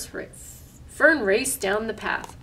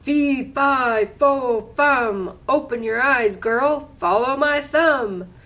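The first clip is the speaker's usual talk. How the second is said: loud booming giant chant; bellowing giant; 3 semitones higher, 6 dB louder, slower than usual. Acted. Giant bellowing directions down to Fern. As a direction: boom it out like a giant. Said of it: "spat out his whistle and bellowed".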